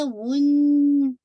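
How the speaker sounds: वुन loud with a long held nasal न; complete ghunna. Shown as a demonstration. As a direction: hum the न of वुन for its full count. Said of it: "most complete गुन्ना करना है". (वुन में पूरा गुन्ना, ऊँची आवाज़ से)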